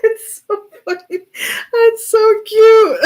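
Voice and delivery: high, slow squeal of laughter; squealing with delight; laughing. Real, not acted. Reader gushing over the duo, squealing with laughter. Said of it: "I can't stop laughing now".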